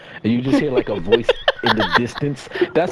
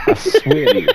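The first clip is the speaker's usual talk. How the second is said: high squealing laugh; cracking up mid sentence; 4 dB louder than usual. Real, not acted.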